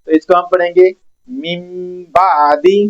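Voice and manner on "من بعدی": من بعدی drawn out slowly with the nun turned to a clear m, mim ba'di; iqlab demo. Shown as a demonstration. Quran teacher showing students, slow iqlab reading. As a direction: stretch من بعدی so the nun becomes m. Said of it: "یہاں پر میم کی آواز پیدا ہوگی".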